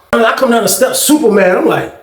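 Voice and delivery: normal talking voice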